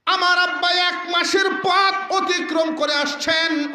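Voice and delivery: high, impassioned outburst; anguished reproach. Acted. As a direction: lament loudly over the father's hard journey. Acted husband's anguished reproach to wife.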